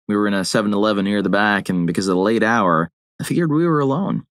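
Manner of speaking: ordinary delivery, nothing marked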